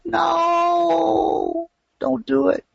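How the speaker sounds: high, crying no; pleading cry. Retold or imitated. Coach screaming at a trader: high crying plea. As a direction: wail at them not to do it. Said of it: "I'm out there screaming".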